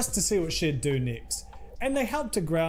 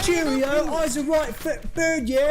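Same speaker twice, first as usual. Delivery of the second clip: high, loud mock cockney chav voice; exaggerated posh-cockney caricature, high-pitched; rough London slang; 11 semitones higher, 5 dB louder than usual. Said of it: "she sounds like".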